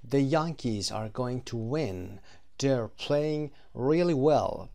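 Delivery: slow, careful example sentences; slow model reading. Shown as a demonstration. Teacher reading the prediction example, slow and clear. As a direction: read them out slowly for learners. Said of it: "teacher models example".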